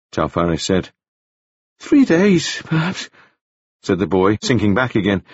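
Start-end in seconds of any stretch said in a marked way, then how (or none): 1.8-3.1 put-on much higher, bright boyish voice; high bright young gentleman. A narrator's reading. Wounded Staines greeting Taufari, high and bright. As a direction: lift it up high and bright like a dazed young toff. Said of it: "speaking in a voice that was high and very bright".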